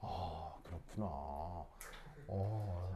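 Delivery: low, soft "oh, I see"; subdued realization. Real, not acted. Teacher taking in news about his student, subdued. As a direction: let it sink in quietly.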